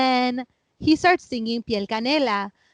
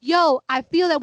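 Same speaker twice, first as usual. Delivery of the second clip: mom agreeing; 5 semitones higher, 4 dB louder than usual. Retold or imitated. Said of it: "my mom's like".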